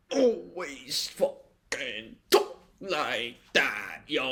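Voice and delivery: hammed-up drawl, very slow; exaggerated talking style. Shown as a demonstration. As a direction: stretch every word out into a silly drawl. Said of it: "I'm just doing it more today".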